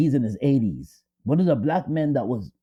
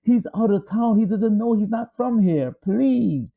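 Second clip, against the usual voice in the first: higher pleading voice, a touch of desperation; wife pleading for the boy, higher and urgent; 6 semitones higher than usual. Retold or imitated. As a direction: beg them to spare the boy. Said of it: "she pleaded with them".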